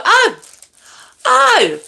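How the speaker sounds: high, drawn-out "oh" of realisation; mock surprise. Streamer retelling seeing a viewer pop up, high mock surprise. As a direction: pipe up high, as if just noticing. Retold reaction on spotting the commenter.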